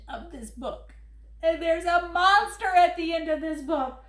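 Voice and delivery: furry monster Grover, fretful and pleading. Acted. Grover reading his book to the kids, worried and pleading. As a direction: play lovable Grover begging the child not to turn pages.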